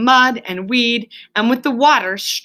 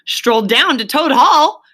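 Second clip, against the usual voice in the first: louder, slow, astonished; excited disbelief; 5 semitones higher, 7 dB louder, slower than usual. A narrator's reading. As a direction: Rat can't believe his ears. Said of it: "greatly excited".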